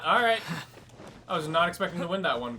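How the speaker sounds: ordinary delivery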